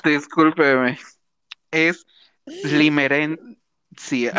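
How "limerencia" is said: syllable by syllable. Shown as a demonstration. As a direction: slowly split into syllables, limerencia. Word read syllable by syllable.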